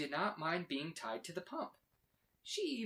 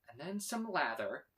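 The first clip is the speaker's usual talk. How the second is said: usual speaking voice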